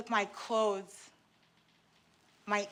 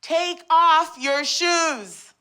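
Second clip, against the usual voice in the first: loud, slow, high commanding shout; barked orders; 8 semitones higher, 14 dB louder, slower than usual. Acted. Officer ordering a new patient through intake, loud drawn-out commands. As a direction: draw out each order at full volume.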